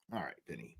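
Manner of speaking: unvoiced dry whisper; whispered aside. Real, not acted. Streamer whispers a dry reply to the host. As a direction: breathe it out, unimpressed. Sarcastic aside after mock rant.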